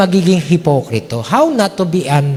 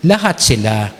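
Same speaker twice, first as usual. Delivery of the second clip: usual speaking voice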